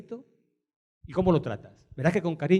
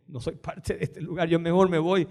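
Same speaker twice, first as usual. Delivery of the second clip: ordinary delivery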